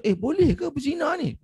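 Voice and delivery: shocked companion, high; high, incredulous question; surprised. Retold or imitated. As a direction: voice leaps up in disbelief. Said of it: "Sahabat pun terkejut".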